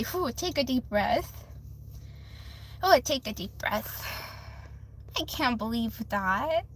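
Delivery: soft, low self-soothing; calming oneself. Real, not acted. Streamer settling down after a rant, real hushed disbelief. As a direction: bring it down quietly, as if steadying yourself.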